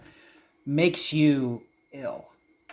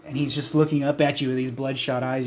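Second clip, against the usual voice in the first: ordinary talk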